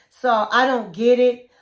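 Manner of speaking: normal talking voice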